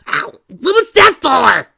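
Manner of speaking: sharp cry of pain, then a loud high protest; pained yell. Acted. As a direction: yell it out, hurt and outraged. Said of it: "Trixie yelled".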